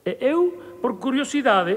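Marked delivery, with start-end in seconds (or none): none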